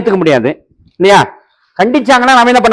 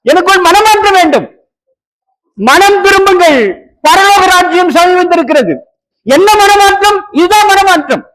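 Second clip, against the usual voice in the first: raised, forceful sermon voice; preaching; 6 semitones higher than usual. Acted. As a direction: preach it out to the congregation. Preacher exhorting congregation. (உரத்த பிரசங்கக் குரல்)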